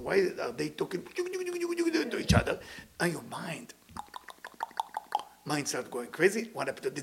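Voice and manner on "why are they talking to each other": mock suspicious onlooker. Jealous onlooker wondering.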